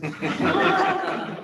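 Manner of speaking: high, loud laugh at the punchline; real burst of laughter. Real, not acted. The teller laughs out loud after Sam's Alzheimer's joke. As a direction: crack up at the joke.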